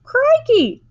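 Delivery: very high, loud burst of surprise; astonished exclamation. A narrator's reading. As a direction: yelp it out in amazement. Mike Teavee astonished at squirrels.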